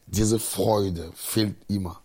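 usual speaking voice

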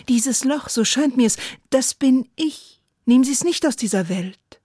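declaimed near-shout within a poem; calling out in verse. Acted. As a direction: lift the voice as if almost crying out, still reciting. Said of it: "Fast wollte ich rufen".

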